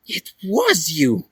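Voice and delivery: slow, high and insistent; insisting. A narrator's reading. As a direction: press the accusation home. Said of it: "Dally insisted".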